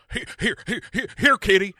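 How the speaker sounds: stuttering computer-host impression; Max Headroom. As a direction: repeat it like a skipping recording. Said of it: "do it one time like Max Headroom".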